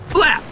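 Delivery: high, short mouth-made shot; gunshot imitation. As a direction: mimic one rifle shot.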